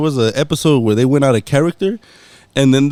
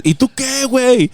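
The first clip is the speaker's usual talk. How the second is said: higher, teasing "¿Y tú qué, güey?"; mock challenge; 8 semitones higher than usual. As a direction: imitate the brother's cheeky dare. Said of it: "he says, he says".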